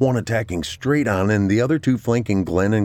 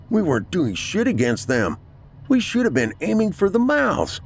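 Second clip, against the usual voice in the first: high-pitched, worked-up voice; excited calling out; 12 semitones higher than usual. A narrator's reading. Captain reports how to hit the creatures, excited. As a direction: call it out high, fired up.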